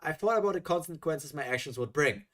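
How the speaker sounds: usual speaking voice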